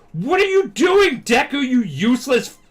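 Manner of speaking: high, loud angry berating; acted furious rival; Bakugo. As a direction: shout the insult, stretching the words. Acts Bakugo berating Deku.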